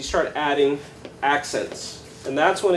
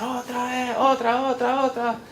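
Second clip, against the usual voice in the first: lightly chanted crowd call, pitched up; crowd calling for encore; 7 semitones higher, 3 dB quieter than usual. Retold or imitated. Audience begs the guitarist for an encore, insistent chant. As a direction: imitate an audience clamouring for more.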